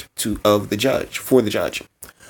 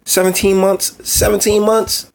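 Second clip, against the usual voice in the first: incredulous; 11 semitones higher, 4 dB louder than usual. Real, not acted. Outraged at the child's age.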